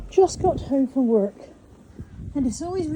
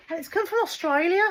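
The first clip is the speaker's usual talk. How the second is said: badly faked Australian accent, pitched up high; terrible Australian accent; 8 semitones higher than usual. Vlogger announcing where her parcel came from, bad Australian accent. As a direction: attempt an Australian accent and miss, higher than usual. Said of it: "that's a terrible accent".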